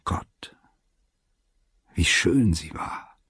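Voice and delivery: hushed, near-whispered awe; ehrfürchtig leise. A narrator's reading. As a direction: breathe it slowly, half to yourself. Narrator's hushed memory of her beauty. (leise und langsam staunend)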